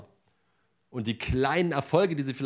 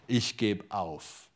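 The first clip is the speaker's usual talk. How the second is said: mock giving up; 3 semitones lower, 3 dB quieter, much slower than usual. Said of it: "ich könnte sagen".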